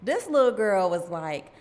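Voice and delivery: ordinary talk, nothing marked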